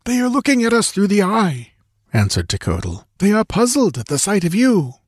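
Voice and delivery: high, tense voice of the guide; frightened guide Tekodl. A narrator's reading. Tekodl answers Conan without looking back, high and tense. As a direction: pitch up high for the nervous guide.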